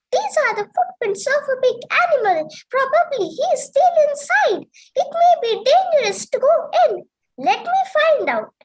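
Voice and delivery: high, fast, excited jackal; a high nervous little jackal sizing it up; squeaky quick jackal muttering. A narrator's reading. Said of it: "he said to himself".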